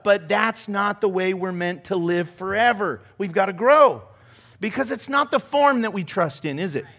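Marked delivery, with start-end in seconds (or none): none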